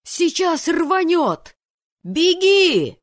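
high, very loud scream of warning; full-voiced, drawn-out alarm cry; panicked yell. A narrator's reading. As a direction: yell to run before the blast. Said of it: "завопил другой".

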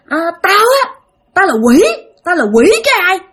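a hint of a high demon-child voice; possessed boy. Possessed boy answers villagers, lightly voiced defiant demon. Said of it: "thằng này nó nói".

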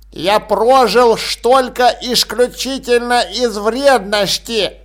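put-on slow, spiteful old grumbler; grumpy old man. A narrator's reading. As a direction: sneer at the greedy family. Old man grumbles about family.

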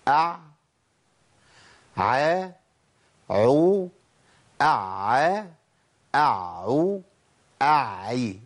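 أع، ع، عو، أع، أعو، أعي as isolated model syllables; ayn drill. Shown as a demonstration. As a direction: recite أع، ع، عو، أع، أعو، أعي one by one with pauses, ayn clear. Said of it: "نقولهم بقى ورا بعض". (أع، ع، عو، أع، أعو، أعي بنطق تعليمي متقطع)

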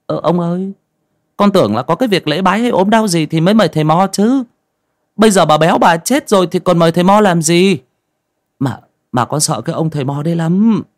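put-on nervous young villager objecting; reluctant young man. A narrator's reading. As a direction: protest timidly in a high voice. Frightened boy objects to elder.

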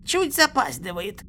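high, gurgled, bubbling words; gurgling. A narrator's reading. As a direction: speak through gurgling. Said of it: "пробулькал Ву".

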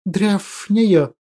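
slow, quiet drawn-out hiss; hissing creature voice. Acted. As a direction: hiss the words out slowly. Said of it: "Перевёл я его шипение".

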